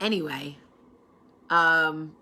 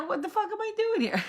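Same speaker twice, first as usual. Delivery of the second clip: high-pitched mock exasperation; talking to herself; 14 semitones higher than usual. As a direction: gripe to yourself like your mother, pitched way up. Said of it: "I sound like my mother".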